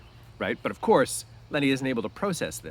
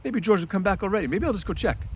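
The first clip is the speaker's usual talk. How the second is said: put-on higher, anxious childlike voice; Lenny worried George is gone, higher, fretful; frightened Lenny; 11 semitones higher, 6 dB louder than usual. Lenny frets to Crooks that George may be gone, higher and anxious. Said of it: "in a frightened voice".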